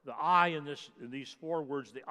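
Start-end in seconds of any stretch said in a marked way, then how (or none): 0.1-0.5 "I" punched hard and loud; "I" as a loud, emphatic declaration. Shown as a demonstration. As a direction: hit the single word "I" with full force. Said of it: "is meant to be emphatic".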